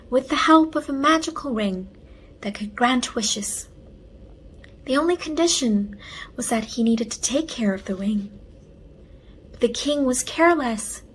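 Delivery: ordinary delivery, nothing marked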